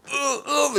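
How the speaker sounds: high mock "ugh" of disgust; disgusted retching. Dad mimics son recoiling from the toilet smell, disgusted. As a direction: imitate a kid gagging at a smell.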